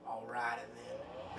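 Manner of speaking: normal talking voice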